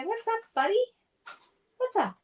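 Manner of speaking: high-pitched greeting to a bird; pet talk. Real, not acted. Talking to her pet bird.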